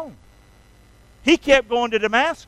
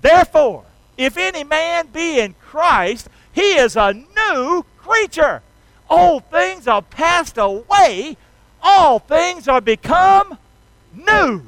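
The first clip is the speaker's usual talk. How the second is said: louder, higher, slow and stirring; with enthusiasm; 7 semitones higher, 6 dB louder, slower than usual. Shown as a demonstration. A preacher leading the congregation in an enthusiastic recitation. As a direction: proclaim it, every word lifted. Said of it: "Can we say that with a little enthusiasm?".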